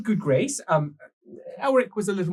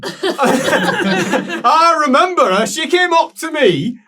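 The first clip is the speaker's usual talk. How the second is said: laughing; 8 semitones higher, 7 dB louder, much slower than usual. Real, not acted. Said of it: "laughing recognition".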